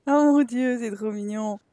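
ordinary talk, nothing marked

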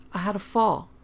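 put-on small, sheepish child voice. A narrator's reading. Child confessing.

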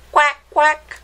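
mock duck call; duck quack. Imitating duck.

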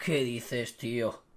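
low, hushed, deflated voice; stunned disbelief. Real, not acted. Streamer stares at a duplicate Cruyff, stunned and hushed. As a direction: say it quietly with long stunned silences.

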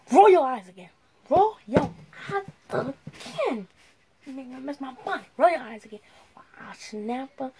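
stern mom scolding loudly. Pretend. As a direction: threaten like an angry mother. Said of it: "put my mom voice on".

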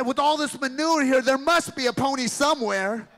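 put-on thrilled child's exclamation; excited boy. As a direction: shout it like a kid who found treasure. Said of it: "And he said".

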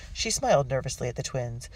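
ordinary delivery, nothing marked

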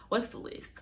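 normal talking voice

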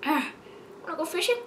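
ordinary talk, nothing marked

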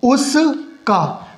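stretched very slow, falling; slow teaching drawl on उसका; drawn out. Shown as a demonstration. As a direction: draw the word out slowly for the class. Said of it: "तो क्या हो जाएगा".

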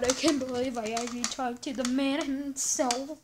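ordinary talk, nothing marked

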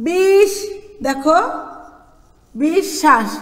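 "বিশ্বাস" split as bish-shash; doubled consonant. Shown as a demonstration. Teacher showing pupils the doubling, "বিশ্বাস" as bish, then shash. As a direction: sound the "sh" of "বিশ্বাস" twice, syllable by syllable. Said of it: "দুইবার উচ্চারণ করব আমরা".

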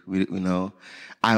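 ordinary delivery

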